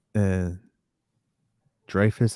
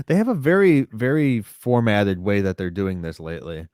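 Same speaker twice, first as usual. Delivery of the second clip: ordinary talk, nothing marked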